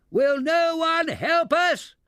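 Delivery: high, loud, slow roar. A narrator's reading. Mister Teddington calling to the angry bears, loud slow roar. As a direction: bellow for help across the lake. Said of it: "roared Mister Teddington".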